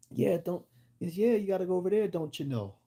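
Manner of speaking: exaggerated Fargo-style Upper Midwest twang, pitched high and loud; Upper Midwest accent. Showing listeners how they talk in Fargo, mock Upper Midwest accent. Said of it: "if you ever watched the movie Fargo".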